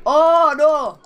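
frustrated loud outburst; loud groan. Real, not acted. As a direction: shout in real dismay. Losing badly in game.

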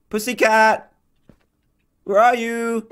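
high sing-out call to a cat. Real, not acted. Player calls the lurking cat, calling out.

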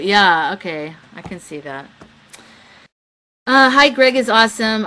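ordinary talk

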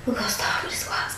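unvoiced, soft whisper. Real, not acted. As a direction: whisper it to the camera. Repeats line in a whisper.

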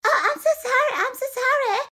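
very high, sugary mock apology; passive-aggressive apology. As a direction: exaggerate a fake, high-pitched sorry. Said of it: "passive aggressive conflict".

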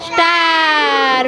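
one long, slowly stretched word; drawn-out naming. Shown as a demonstration. Teacher leads children naming shapes, very drawn out. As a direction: hold the vowel long for the class.

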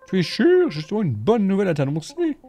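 high, bright female voice; high character voice. Acted. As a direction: lift into a chirpy woman's voice. Voicing character in dialogue.

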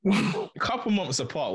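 can't hold back the giggle; laughing. Real, not acted. Host laughs at the age mix-up, giggling.

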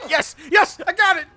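high, loud victory cry; triumphant shout. Real, not acted. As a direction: yell in triumph. Grabs gun, triumphant.

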